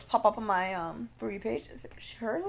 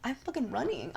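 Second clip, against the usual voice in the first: usual speaking voice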